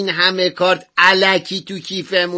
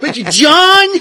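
shocked protest; 6 semitones higher, 4 dB louder, slower than usual. Acted. Startled protest.

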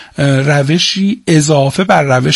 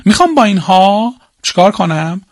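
ordinary delivery

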